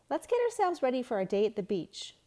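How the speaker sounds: upbeat, quick teacher call; rally the class brightly; cheerful teacher voice. A narrator's reading. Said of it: "said Luke's teacher".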